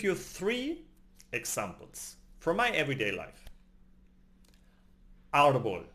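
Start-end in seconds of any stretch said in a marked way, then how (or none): 5.3-5.8 "Árbol" with the opening A punched louder; stress on first syllable. Shown as a demonstration. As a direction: hit the A of "Árbol", let the end fall. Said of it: "The A is pronounced".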